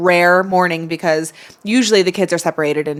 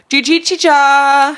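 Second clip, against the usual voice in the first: put-on high childlike mispronunciation; 8 semitones higher, 6 dB louder, slower than usual. Child's mangled greeting.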